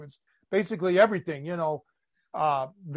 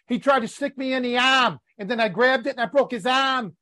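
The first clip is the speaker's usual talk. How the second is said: higher-pitched mock Boston accent, R's dropped; mimicking Boston accent; 4 semitones higher than usual. Host quoting guest's foreign words back to him, mock Boston accent. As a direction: imitate a thick Boston accent. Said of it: "you weren't speaking English, but you said".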